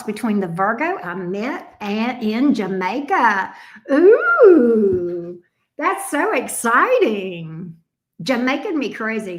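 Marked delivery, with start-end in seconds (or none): 3.9-5.4 loud, high delighted ooh; a loud thrilled ooh; excited ooh. Real, not acted. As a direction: squeal with delight at the question. Excited by the Jamaica question.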